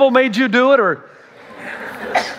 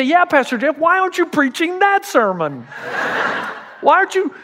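higher, eager mock listener; mock congregant; 7 semitones higher than usual. Congregant teasing the pastor, higher and eager. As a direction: needle the pastor playfully. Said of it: "You say".

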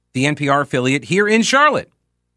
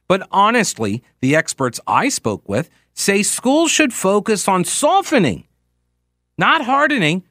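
higher, emphatic reporter delivery; quoted correspondent read; 3 semitones higher than usual. Acted. Host quoting education correspondent, emphatic news read. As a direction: punch the key words like a radio report. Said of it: "So, quote".

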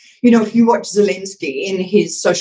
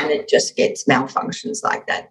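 vocal fry; 5 semitones lower, 4 dB quieter than usual. Shown as a demonstration. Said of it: "vocal fry".

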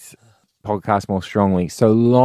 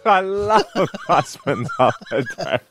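laughing; 7 semitones higher than usual. Real, not acted. Laughing through the phrase.

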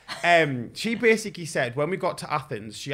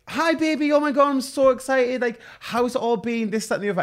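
much higher, giddy sweetheart voice on the phone; over-the-top excited lover, pitched way up; gushing boyfriend; 10 semitones higher, 5 dB louder than usual. Retold or imitated. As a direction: play the smitten boyfriend squealing hello.

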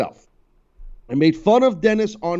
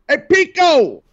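loud, high shout across the room; calling out; 14 semitones higher, 8 dB louder, slower than usual. Acted. Host hailing his cohost by name, loud high shout.

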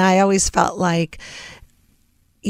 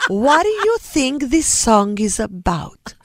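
slow, higher Greek mom voice; Greek mother's accent; 5 semitones higher, slower than usual. Retold or imitated. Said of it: "She had an accent".

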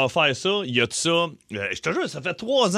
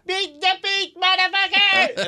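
put-on high, childish yell; kid yelling; 14 semitones higher, much slower than usual. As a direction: imitate a little boy shouting it from the driveway. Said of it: "puis il crie". (imitation d'un petit garçon qui crie, aigu)

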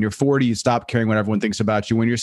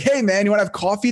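higher, overeager hello; eager mock greeting; 7 semitones higher than usual. Comic offering coffee to comics, overeager and higher. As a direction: play your too-eager younger self. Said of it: "like, everybody, like".

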